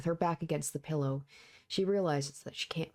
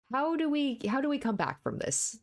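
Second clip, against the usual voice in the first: flustered disbelief; 9 semitones higher than usual. Real, not acted. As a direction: blurt it out, embarrassed. Reaction after awkward scene.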